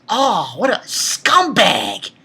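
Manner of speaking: ordinary talk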